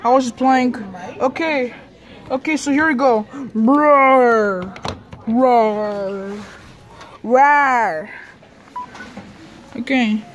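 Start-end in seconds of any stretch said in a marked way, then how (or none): 3.5-8.1 a string of playful pretend-monster roars. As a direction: roar again and again like a toy monster. Kid playing negative monster.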